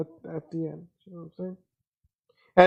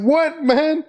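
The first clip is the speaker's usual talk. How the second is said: high, startled "what, man?"; baffled pitch jump on a retold question; confused surprise; 10 semitones higher than usual. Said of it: "I was like".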